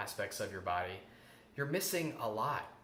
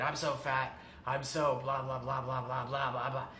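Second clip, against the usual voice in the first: negative self-talk tone. Shown as a demonstration. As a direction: demonstrate the inner critic putting yourself down. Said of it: "That's a negative tone of voice".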